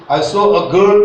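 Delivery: put-on "girl" loud, rising; stress on girl. Shown as a demonstration. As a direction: lean hard on "girl". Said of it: "means girl is important".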